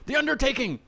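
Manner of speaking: loud, high announcer shout; exclaimed band name. Real, not acted. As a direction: belt it out with an exclamation point. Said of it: "I have to say it like that".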